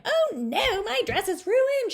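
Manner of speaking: high, loud dismayed yell; girl in the mud yelling in dismay. A narrator's reading. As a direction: wail about the ruined dress. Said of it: "she yelled".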